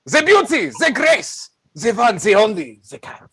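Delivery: high, loud, grand build-up; theatrical fanfare announcement; grand showman unveiling. Acted. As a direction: present the star like a ringmaster.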